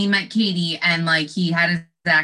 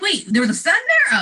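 laughing mock surprise; 11 semitones higher, 4 dB louder than usual. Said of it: "I'm like".

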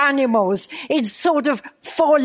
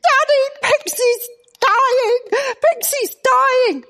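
put-on kids screaming; 12 semitones higher, slower than usual. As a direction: scream it like a terrified child. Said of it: "Kids started screaming".

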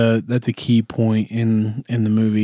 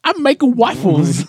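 much higher, silly mock voice; Shrek impression; 13 semitones higher than usual. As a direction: squeak the line like a goofy impression. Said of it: "Let me do you a line from the famous, uh, Shrek".

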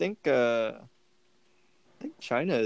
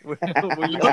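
laughing; 5 semitones higher, 4 dB louder, faster than usual. Real, not acted. Laughing while teasing the question.

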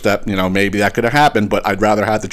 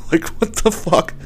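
exasperated; 7 semitones higher than usual. Real, not acted. Exasperated at the flimsy package.

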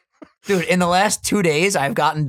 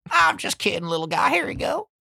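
playful Southern candy giver; 5 semitones higher than usual. Pretend. Handing out Bibles and peaches.

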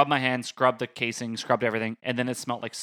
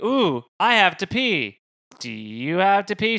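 higher, slower, put-on innocent voice; fake casual; 5 semitones higher, 3 dB louder, slower than usual. Hiding the proposal from girlfriend, higher put-on innocence. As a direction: act sneakily casual about needing to pee. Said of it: "I'm like".

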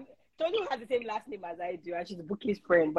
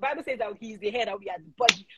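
ordinary talk

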